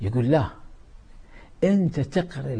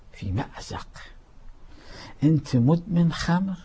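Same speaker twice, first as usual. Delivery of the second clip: ordinary delivery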